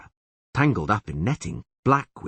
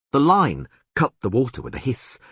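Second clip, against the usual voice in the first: ordinary delivery, nothing marked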